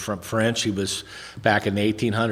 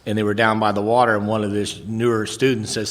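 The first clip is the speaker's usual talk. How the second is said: ordinary delivery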